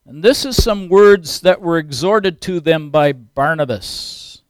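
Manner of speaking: ordinary delivery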